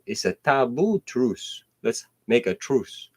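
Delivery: normal talking voice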